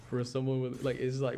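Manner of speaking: usual speaking voice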